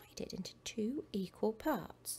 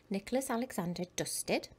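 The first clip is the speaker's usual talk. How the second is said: usual speaking voice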